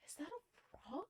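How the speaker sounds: very soft, puzzled question; retelling a tentative realization; hushed disbelief. Retold or imitated. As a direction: ask it under your breath, unsure. Squinting at shape, unsure.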